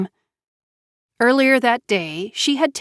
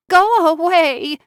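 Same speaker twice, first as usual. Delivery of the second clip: high, slow tearful cry; sobbing; 10 semitones higher, 4 dB louder, slower than usual. A narrator's reading. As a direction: push her away through tears. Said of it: "she sobbed".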